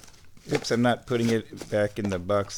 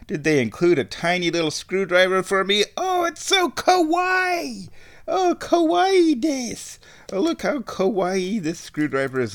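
excited anime fan; 8 semitones higher than usual. Pretend. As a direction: squeal it like a cute-obsessed fan. Cooing kawaii over screwdriver.

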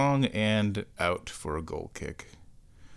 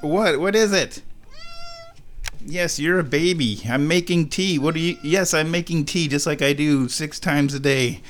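high, cooing pet voice; 5 semitones higher than usual. Real, not acted. Cat hassles her while making tea.

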